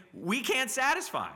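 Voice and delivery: very high, stretched-out plea; crying out. Acted. Sacrificial animals admitting they cannot save, crying out. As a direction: preach it as an impassioned cry of inadequacy. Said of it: "they're also saying".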